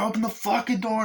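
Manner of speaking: repeated high mock thug yell; yelling gunman. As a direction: keep hammering the demand, pitched high. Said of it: "and the guy's like".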